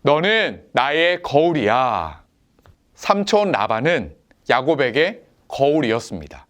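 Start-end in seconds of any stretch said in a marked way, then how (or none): 0.0-2.2 slow, clear line to echo once more; slow repeat-after-me line. Shown as a demonstration. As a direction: lead the repeat slowly, word by word. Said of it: "한 번 더 따라해 볼까요?". (천천히 또박또박 다시 따라 하게)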